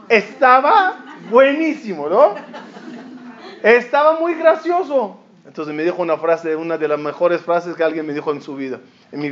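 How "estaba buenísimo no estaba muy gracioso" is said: higher, laughing coaxing; laughing plea. Retold or imitated. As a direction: laugh through it to win him over. Retelling his own plea to a friend.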